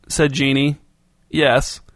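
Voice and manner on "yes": ordinary delivery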